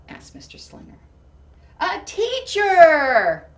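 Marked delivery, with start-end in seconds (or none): 1.8-3.5 a slow, drawn-out loud shout, much higher; the whole class yells it out together, slowly and joyfully. Acted. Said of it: "Lily's response was the loudest".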